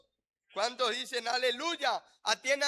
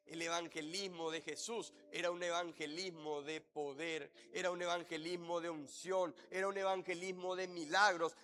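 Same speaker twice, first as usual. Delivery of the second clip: ordinary delivery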